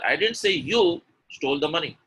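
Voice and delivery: weight squarely on "you"; stress on you. Shown as a demonstration. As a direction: punch "you", pause before the rest. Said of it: "the stress is on you".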